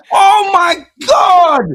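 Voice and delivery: high, drawn-out laughing scream; hysterical disbelief. Real, not acted. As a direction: shriek it, helpless with laughter. Listener cracking up at the story.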